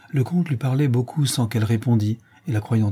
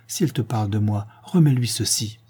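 plain reading voice

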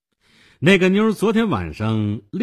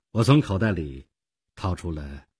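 usual speaking voice

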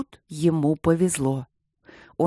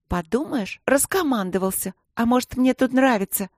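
повышенный голос задетой девчонки; огрызнись, не желая подчиняться; девчачий вызов; 6 semitones higher than usual. A narrator's reading. (сыграй упрямое «подумаешь»)

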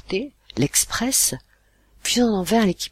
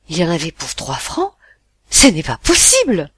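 louder, rising into shocked disbelief; surprised mother; 10 dB louder than usual. Acted. Mother questioning the boy about the price, shocked. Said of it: "ma mère surprise demanda".

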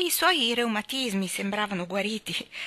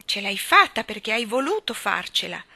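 joyful cheering; 5 semitones higher, 5 dB louder than usual. A narrator's reading. Mary cheers Colin's first digging, overjoyed and high. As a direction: cheer him on, bursting with joy. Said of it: "Mary non stava in sé dalla gioia".

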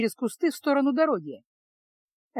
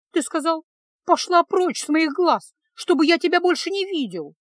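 high, loud indignant quote; irritated; 7 semitones higher, 4 dB louder than usual. A narrator's reading. Girl reminding the mage what he said, irritated. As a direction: throw his words back at him. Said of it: "с раздражением напомнила девушка".

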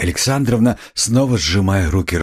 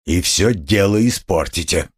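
plain reading voice

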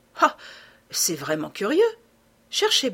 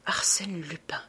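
nom chuchoté, sans voix; murmuré; 5 dB quieter than usual. A narrator's reading. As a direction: souffler le nom, stupéfait. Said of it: "murmurai-je". (le narrateur reconnaît Lupin, en un murmure)

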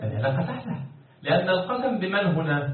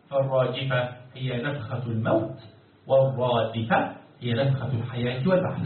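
ordinary delivery

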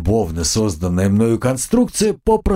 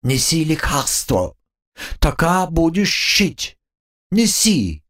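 slow high lisping squeak; slushy lisp; 7 semitones higher, slower than usual. A narrator's reading. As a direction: lisp it like a bossy bug. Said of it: "шепеляво произнёс".

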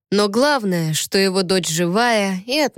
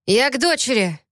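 called out; 5 semitones higher than usual. Acted. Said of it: "крикнул он Болину".

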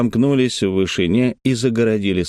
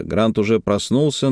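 usual speaking voice

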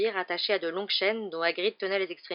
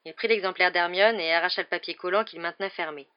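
ordinary delivery, nothing marked

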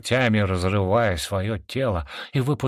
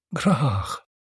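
higher-pitched, moaning lament; half-sigh, half-moan; 6 semitones higher than usual. A narrator's reading. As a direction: groan the name out in sorrow. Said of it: "послышался полувздох, полустон Сайроона". (полувздох, полустон)

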